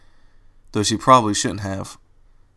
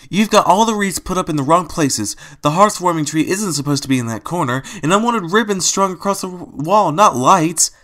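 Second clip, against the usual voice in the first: scolding; 8 semitones higher, 4 dB louder than usual. Acted. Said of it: "she shouted".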